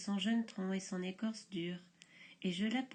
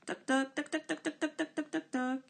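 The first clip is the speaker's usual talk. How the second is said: 1 toc toc imité; 5 semitones higher than usual. A narrator's reading. Pivert imité.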